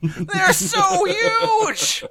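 high, slow, squealing exaggeration; mock excited gushing. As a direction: gush over them like it's huge. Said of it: "you live in a world where you're like".

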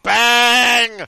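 slow, loud spooky emphasis; dramatic bang. Retold or imitated. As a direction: stretch out the big bang. Dramatic story moment.

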